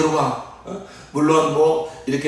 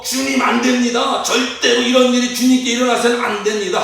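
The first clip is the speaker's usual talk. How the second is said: high-pitched, insistent acted objection; defiant protest; 8 semitones higher than usual. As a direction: plead against it as a defiant Peter. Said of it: "말하면서 예수께 대들었다". (대들듯 높은 목소리로 만류)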